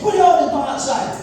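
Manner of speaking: loud, stern command; mum's firm order. Retold or imitated. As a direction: order it like an upset mum. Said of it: "the way she handles the upset is".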